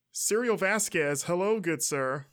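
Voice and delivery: usual speaking voice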